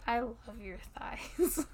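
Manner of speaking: slow teasing whisper. Real, not acted. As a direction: whisper it slowly. Playful intimate whisper.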